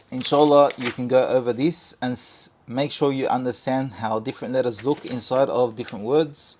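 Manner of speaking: normal talking voice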